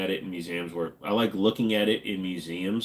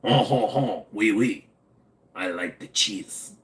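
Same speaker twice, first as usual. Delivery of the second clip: hammed-up French accent; caricatured French speaker, chuckling "oh ho ho"; slower than usual. Shown as a demonstration. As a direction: put on a terrible cartoon French accent. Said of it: "like a stereotypical French accent".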